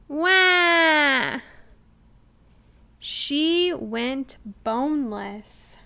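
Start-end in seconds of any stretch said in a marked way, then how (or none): 0.1-1.4 put-on loud high baby bawl; loud crying wail; toddler wail. A narrator's reading. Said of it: "Trixie bawled".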